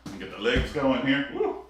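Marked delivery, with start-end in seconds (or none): 1.3-1.7 high whoop of effort; excited whoop. Real, not acted. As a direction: let out a quick high cheer. Exercise exertion cheer.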